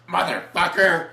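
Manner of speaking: heroine's one-liner. Retold or imitated. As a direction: deliver it as an action-movie kill line. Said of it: "then she just goes".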